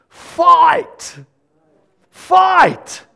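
punchy, high-pitched exhortation; high, forceful command; rallying cry. Real, not acted. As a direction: call them to battle, one word at a time. Urging the congregation to act.

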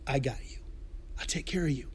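soft, low, tender promise; quiet reassurance. Acted. God to his people in trouble, softly reassuring. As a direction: drop right down and comfort them gently.

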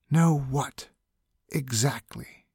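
half-voiced stage whisper; loud whisper. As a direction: whisper it, but nearly full voice. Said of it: "whispered the figure".

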